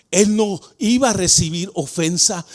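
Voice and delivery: ordinary talk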